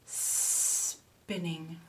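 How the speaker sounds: SPINNING with a long hissed S; long snake sound. Shown as a demonstration. Teacher reads to children, long S. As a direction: linger on the S of spinning.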